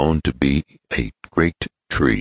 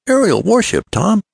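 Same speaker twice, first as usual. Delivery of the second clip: high-pitched, incredulous query; astonished echo; 4 dB louder than usual. Acted. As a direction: echo it back in surprise, pitched way up. Ned echoes in astonishment.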